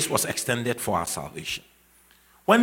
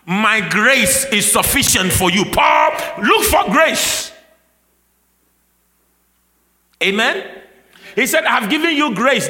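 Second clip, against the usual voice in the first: loud, high, driving sermon voice; impassioned revival preacher; full-force preaching; 8 semitones higher, 8 dB louder than usual. Acted. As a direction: preach it out with rising fire to the crowd.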